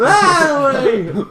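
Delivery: very high loud squeal; a shrill meme shout of the word. Pretend. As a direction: scream it like a meme.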